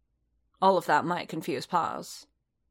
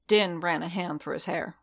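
usual speaking voice

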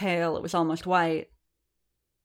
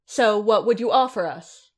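demanding; 4 semitones higher, 6 dB louder than usual. A narrator's reading. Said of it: "she demanded".

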